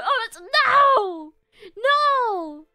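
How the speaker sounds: loud playful no; playful loud protest. Real, not acted. Refusing surgery on her palm, loud mock protest.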